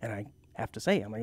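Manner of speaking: normal talking voice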